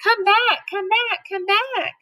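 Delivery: high repeated calling; chasers yelling. Acted. The whole crowd chasing, high calls. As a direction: call him back again and again. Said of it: "were yelling too".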